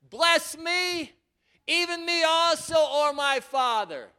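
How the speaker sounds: loud, high pleading wail; bitter cry. A narrator's reading. Esau begging his father for a blessing, loud bitter cry. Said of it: "cried with a great and exceeding bitter cry".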